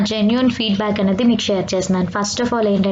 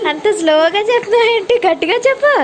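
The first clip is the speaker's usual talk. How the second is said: urging louder; 12 semitones higher, 5 dB louder than usual. Real, not acted. Mother urges her daughter to thank grandpa louder, high coaxing call. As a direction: call out to egg the little one on.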